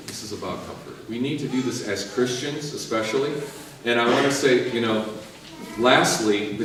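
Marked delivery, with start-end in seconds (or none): 0.1-1.1 "This is about comfort" hushed and falling; soft repeat. Shown as a demonstration. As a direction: drop to a near murmur.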